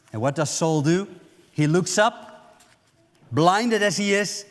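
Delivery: normal talking voice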